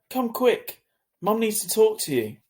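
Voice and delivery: high, loud and hurried plea; urgent call. A narrator's reading. Sister urging Augie to come quick, high and loud. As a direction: urge him to come now.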